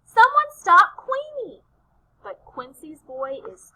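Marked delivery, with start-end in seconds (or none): 0.0-1.6 high, loud call for help; raised storybook cry of alarm. A narrator's reading. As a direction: yell urgently after a thief. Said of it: "cries Quincy".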